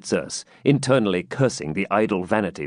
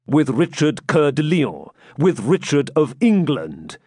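"With Richard Coeur de Lion" pitched up and hammered home; "Richard of England" proclaimed higher, with full emphasis; emphatic insistence; 4 semitones higher than usual. A narrator's reading. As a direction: press each title in "with Richard of England". Knight insists emphatically on the name.

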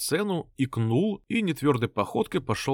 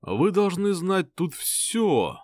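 breathy vintage purr; 7 semitones higher, much slower than usual. Acted. Blonde continues languidly.

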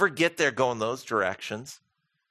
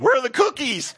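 put-on hopeful kids; 12 semitones higher, 6 dB louder than usual. Kids expect cookie order again.

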